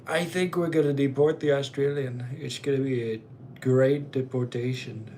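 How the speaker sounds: hammed-up politician impression in mock alarm; Trump. Streamer warning chat of a wild Australian, mock-alarmed politician impression. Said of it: "I started a Trump impression".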